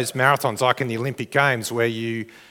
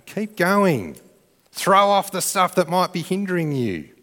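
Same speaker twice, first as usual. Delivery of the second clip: higher-pitched urging, short of a shout; 6 semitones higher than usual. Acted. Crowd urging the runner to shed hindrances, mildly acted urging. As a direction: urge the runner on in a raised pitch. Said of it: "And they're urging you".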